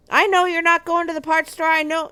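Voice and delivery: high, loud accusing yell at the husband; reenacted scream. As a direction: scream it at him down the phone. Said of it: "screaming at him".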